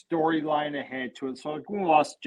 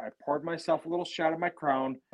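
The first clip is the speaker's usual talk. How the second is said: ordinary talk, nothing marked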